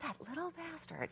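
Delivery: jealous mutter. Retold or imitated. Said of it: "And I'm like".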